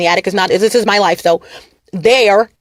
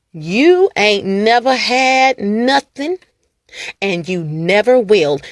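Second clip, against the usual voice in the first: slow acted delivery; slower than usual. Shown as a demonstration. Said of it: "Anyone wants me to do some acting".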